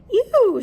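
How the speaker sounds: high, loud "ew" of disgust. Acted. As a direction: squeal it out in disgust. Goldilocks disgusted by cold porridge.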